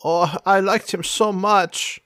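put-on high, theatrical accented wail; Sans Serif, anguished. Regretting the death she caused, melodramatic high voice.

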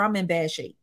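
usual speaking voice

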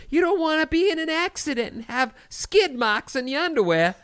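put-on high-pitched nagging mom in a Boston accent; accidental Boston accent. Quoting your mom's underwear warning, in a put-on Boston accent. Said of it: "I don't know what accent that was".

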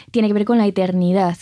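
ordinary delivery

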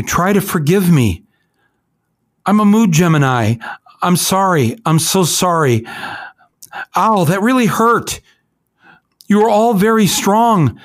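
high, tender imitation of his last words; pleading Elijah McClain. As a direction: voice a gentle young man begging forgiveness. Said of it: "He says".

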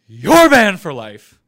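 sudden booming yell with drawn-out words; mock angry shout. Host banning a repeat questioner from his chat, mock angry shout. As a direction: bellow a playful banishment.

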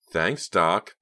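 loud mock gratitude; sarcastic. A narrator's reading. Sick driver to the bossy leader, sarcastic. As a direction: thank him with open sarcasm. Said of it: "Pam said sarcastically".

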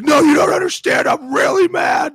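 high-pitched, very loud imitation of a raging man; mock furious shout. Player imitating an angry rival after a late score, mock furious shout. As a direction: shout it as a hammed-up tantrum, far above normal pitch. Acts out an angry opponent quoted.

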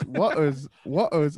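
loud mock English accent on the word; mimicked English accent. Player mocks the caller's word, loud mimicked English accent. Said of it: "We have it with waters".

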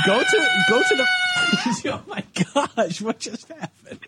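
high-pitched giggling breaking through words; trying to talk through helpless laughter; laughing fit. Real, not acted. Host losing it while cueing the producer, laughing. Host cracking up mid-question.

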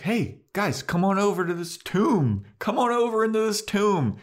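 high, sing-song invitation; mock-friendly Spike beckoning; luring Spike. Spike quoted luring the group.

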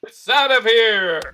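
slow, loud announcer finish; home run call. Pretend.

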